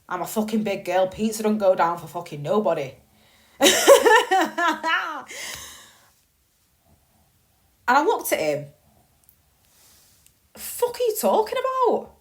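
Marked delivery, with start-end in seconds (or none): 3.6-5.7 a big burst of laughter, higher and louder. Real, not acted. Laughing loudly at her own pizza joke. 10.5-12.1 high, shocked disbelief; incredulous. Retold or imitated. To her brother, stunned and indignant, much higher.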